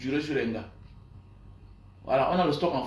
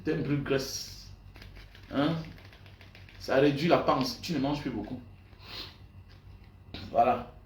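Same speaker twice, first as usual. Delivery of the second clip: usual speaking voice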